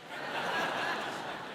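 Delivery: short airy laugh at his own joke; breathy laugh. Real, not acted. Pastor laughing at his own colorblind story, soft laugh. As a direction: let out a soft, voiceless chuckle.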